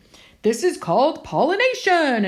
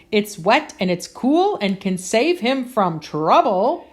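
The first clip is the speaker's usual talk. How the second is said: ordinary delivery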